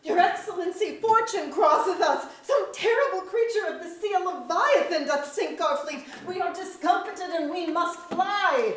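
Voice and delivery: high, loud, breathless alarm; panicked messenger. Acted. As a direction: burst in shouting the disaster. Soldier brings news of leviathan.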